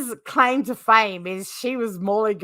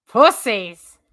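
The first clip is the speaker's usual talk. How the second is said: louder shouted jab; loud insult; 9 dB louder than usual. Real, not acted. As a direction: call it out teasingly to the chat. No one takes the link.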